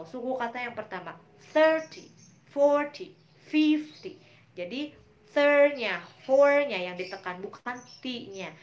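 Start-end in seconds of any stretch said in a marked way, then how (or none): 1.5-4.2 "Thirty, forty, fifty" with the first syllable hit high and loud; first-syllable stress. Shown as a demonstration. Teacher contrasting tens for students, loud first syllables. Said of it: "yang ditekan adalah the first syllable". 5.3-7.4 "third" and "four" said much louder; loud stressed syllables. Shown as a demonstration. Teacher stressing the first syllables for students, loud.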